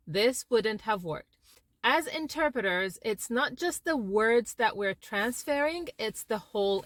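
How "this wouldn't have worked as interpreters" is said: normal talking voice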